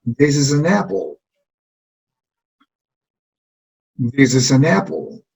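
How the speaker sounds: This is an apple, a little slow; model for repeating. Shown as a demonstration. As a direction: say it clearly for the class to copy. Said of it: "repitan después de mí".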